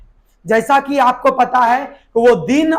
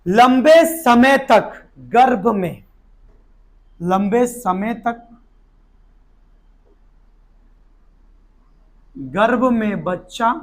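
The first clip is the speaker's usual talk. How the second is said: slow classroom dictation with long pauses; drawn-out words, waiting between chunks; slower than usual. Shown as a demonstration. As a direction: dictate phrase by phrase for students writing. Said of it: "पहले लिखा देता हूं".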